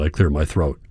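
ordinary delivery

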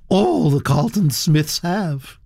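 put-on high, gossipy old aristocrat voice, pitched well up; elderly Duchess. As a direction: chatter on like a grand old lady.

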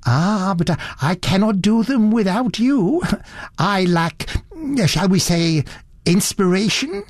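a hint of a fussy elderly gent, curious; fussy gentleman. Satterthwaite admiring.

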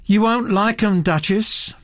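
light cautioning tone; warning. A narrator's reading.

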